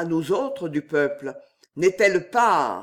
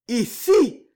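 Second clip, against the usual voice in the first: a choked rasping cry, much higher; rasped; 7 semitones higher, 6 dB louder than usual. Acted. Damiens rasps in horror at the order. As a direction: rasp it out in horror. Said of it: "râla Damiens".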